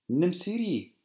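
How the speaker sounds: a lightly voiced coaxing aunt; aunts offering. A narrator's reading. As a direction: hold out the plate sweetly. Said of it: "sagten die Tanten".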